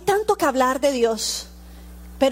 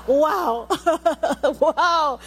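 amazed wow; 2 semitones higher than usual. Real, not acted. Amazed at the conversion story.

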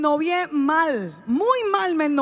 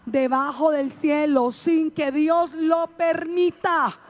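slow, drawn-out line for the crowd to repeat; slow dictation; slower than usual. Shown as a demonstration. Preacher slowly feeding the congregation words to repeat. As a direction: spell it out slowly for them to echo. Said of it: "Dígale".

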